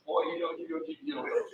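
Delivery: high, far-off mimic of the old man muttering over his dominoes; imitating the old man. Retelling a domino game, mimics the old man's muttering. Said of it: "he would sit there and".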